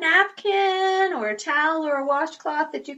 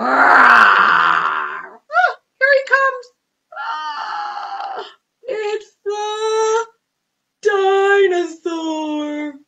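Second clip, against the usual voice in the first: high, loud pretend shriek of alarm; hammed-up fright at a dinosaur; mock panic; 8 semitones higher, 8 dB louder, much slower than usual. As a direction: play scared for the children.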